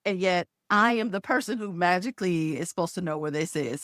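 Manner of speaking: usual speaking voice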